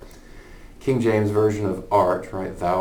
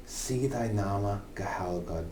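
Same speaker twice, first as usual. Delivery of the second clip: ordinary delivery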